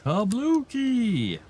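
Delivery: drawn-out gleeful kaboom; explosion sound word. Acted. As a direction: stretch it out like a big blast. Blowing up rocks with charge.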